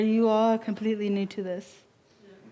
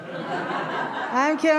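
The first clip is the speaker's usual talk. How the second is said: laughing; 6 semitones higher, much slower than usual. Real, not acted. Teasing the audience about a warm-up.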